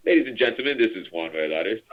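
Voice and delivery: airline captain on the intercom; pilot announcement. Pretend. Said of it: "I sound like a pilot".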